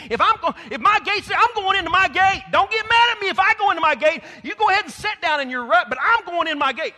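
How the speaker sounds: loud, high, ringing preacher's declaration; full-force preaching. Acted. Preacher declares to the congregation he's going into his gate, loud and ringing.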